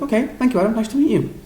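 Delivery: high, light sing-song politeness; courteous high-pitched goodbye; polite interview panel. Retold or imitated. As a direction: imitate a panel's pleasant send-off. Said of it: "And they were like".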